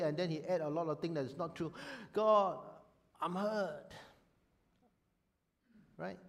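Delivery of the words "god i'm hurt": a sudden loud, high cry of "God" sinking into hurt; pained plea to God. Shown as a demonstration. Preacher acting a wounded believer praying, pained. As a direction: cry out to God, then let the hurt show. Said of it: "So tell God exactly how he hurt".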